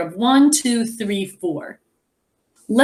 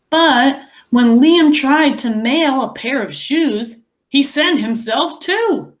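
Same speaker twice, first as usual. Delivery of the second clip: put-on lively read-aloud to children; story narration; 5 semitones higher, 3 dB louder than usual. A narrator's reading. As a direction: tell the story to the class, expressive. Reads the picture book aloud.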